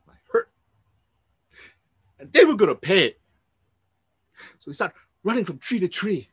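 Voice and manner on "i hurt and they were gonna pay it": loud, hard burst of anger; rising rage. Acted. Soldier turns pain into revenge, loud angry outburst. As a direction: let the fury break out.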